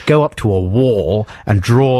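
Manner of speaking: usual speaking voice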